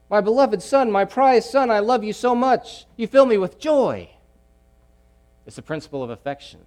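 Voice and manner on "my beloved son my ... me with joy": higher, louder, loving father to son; fatherly Paul. Retold or imitated. Paul telling Timothy his love, lightly voiced fatherly warmth. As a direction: pour out affection like a proud father.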